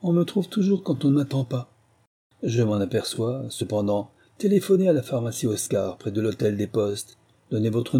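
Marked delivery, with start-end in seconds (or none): 0.0-1.7 pitched-up, smug sneer. Acted. Officer mocks the corporal's question, sneering. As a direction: let a snicker color the line. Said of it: "Il ricana avant de répondre".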